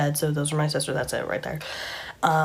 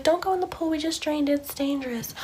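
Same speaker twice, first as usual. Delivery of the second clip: high imitation, tailing off softer; mock-sweet warning; 10 semitones higher, 3 dB quieter than usual. Woman keeping children from the pool, high mock warning. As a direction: warn them off in a high put-on voice. Villain warns children off, retold.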